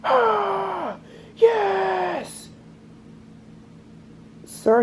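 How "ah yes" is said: brother's scream. Retold or imitated. Brother cheering at his game at dawn, a high yell. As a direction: belt it out like a kid winning his game. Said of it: "all I hear is my brother scream".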